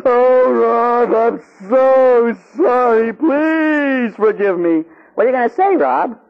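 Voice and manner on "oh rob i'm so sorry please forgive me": hammed-up wail, high and very drawn out; mock tearful begging. Priest begging Rob's forgiveness after another beating, hammed-up tearful wail. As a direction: beg forgiveness in exaggerated fake sobs.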